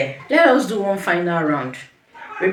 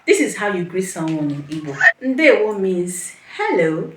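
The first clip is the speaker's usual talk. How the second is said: normal talking voice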